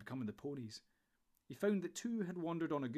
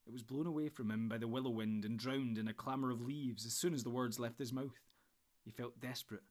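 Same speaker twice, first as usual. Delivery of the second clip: ordinary delivery, nothing marked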